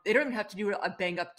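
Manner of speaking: usual speaking voice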